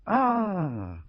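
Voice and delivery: startled Ah; a sharp little cry of surprise; exclaimed. A narrator's reading. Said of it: "Carmela exclaimed".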